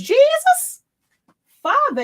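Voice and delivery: a sudden high cry of shock; shocked exclamation. Real, not acted. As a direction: blurt it out high, caught off guard. Shocked at the huge neckline.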